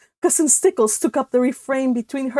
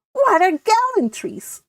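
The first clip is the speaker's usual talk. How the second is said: usual speaking voice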